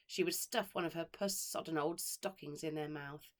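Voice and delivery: usual speaking voice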